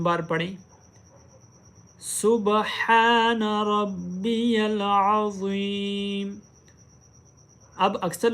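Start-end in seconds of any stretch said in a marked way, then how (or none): 2.0-6.3 slow drawn-out recitation; slow melodic tasbih. Acted. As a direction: recite slowly as in prayer.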